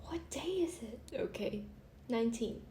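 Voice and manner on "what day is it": horrified whisper. Retold or imitated. Dad waking up confused mid-movie, retold horrified whisper. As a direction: imitate a stunned whisper. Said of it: "horrified expression and whispered".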